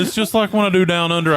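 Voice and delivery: usual speaking voice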